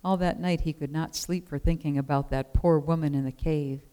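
plain reading voice

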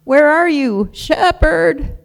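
high, loud cry for help; alarmed calling out. Acted. Lost sheep calls for her shepherd in the dark, alarmed shout.

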